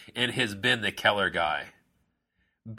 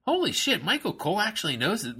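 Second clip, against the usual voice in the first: amazed; 9 semitones higher, faster than usual. Retold or imitated. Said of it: "where it's just like".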